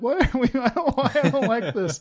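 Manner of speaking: high-pitched naive whine of protest; innocent suburban boy. Retold or imitated. Storyteller mocking his young self caught near a fight, high innocent-boy voice. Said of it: "dumb little innocent middle aged suburban boy".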